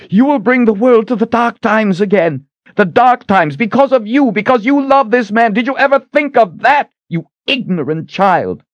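high, furious screamed accusation; raging high-pitched tirade; screaming. A narrator's reading. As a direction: scream the blame at full pitch. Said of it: "Shota was screaming at her".

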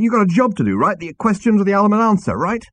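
high, loud, pushy anger; angry. A narrator's reading. Zaphod demanding the question from Arthur, angry. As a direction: press him hard, angrily. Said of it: "he said angrily".

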